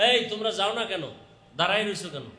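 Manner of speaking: ordinary talk, nothing marked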